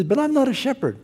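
put-on high-pitched Israeli accent. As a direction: imitate an Israeli man, pitched well up. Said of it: "Israeli accent".

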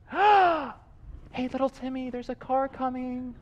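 high, very soft sing-song; mock gentle warning. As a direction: coo it so the child isn't scared. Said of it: "you don't wanna scare your child".

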